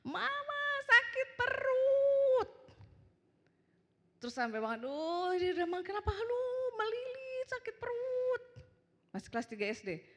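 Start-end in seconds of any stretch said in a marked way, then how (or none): 0.0-2.5 put-on high, drawn-out child's wail for mama; anak teriak-teriak. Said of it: "teriak-teriak". 4.8-8.4 high-pitched imitation of a child in pain; anak kesakitan. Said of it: "teriak-teriak".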